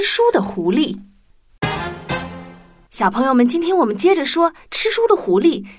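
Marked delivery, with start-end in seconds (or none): none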